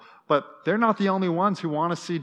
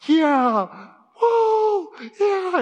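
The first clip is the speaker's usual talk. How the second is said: loud, much higher whooping cheer; crowd cheering; 7 dB louder, much slower than usual. Retold or imitated. Crowd answering the MC, cheering loud and high. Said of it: "the whole crowd was like".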